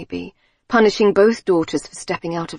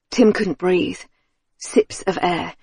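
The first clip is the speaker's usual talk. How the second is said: ordinary delivery, nothing marked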